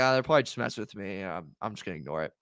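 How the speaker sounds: dismissive mutter. Retold or imitated. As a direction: brush it off under your breath, quickly. Retold dismissing the SOS text.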